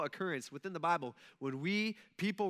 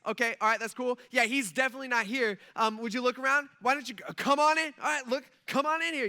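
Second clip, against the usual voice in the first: loud, mostly raised, chatty excitement; pumped angel; 4 semitones higher, 7 dB louder than usual. Pretend. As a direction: show them round as a hyped angel. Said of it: "this angel's probably pretty pumped".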